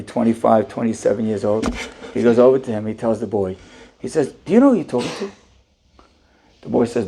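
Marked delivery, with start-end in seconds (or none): 4.4-5.3 raised, pointed rhetorical question; stern scolding. Retold or imitated. Syrian man scolds the young owner, pointed. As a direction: put the boy in his place.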